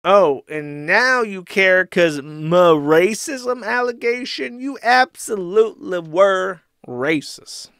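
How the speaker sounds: high, drawn-out mock outrage; slow, whining indignation, hammed up; mock an outraged accuser; Ethan Ralph. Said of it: "To which Ralph replies and says".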